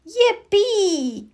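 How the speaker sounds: high, joyful yippee; excited cheer. A narrator's reading. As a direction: whoop with glee. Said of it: "cried Froggy".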